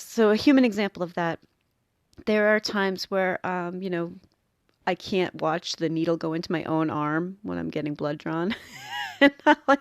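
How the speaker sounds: ordinary talk, nothing marked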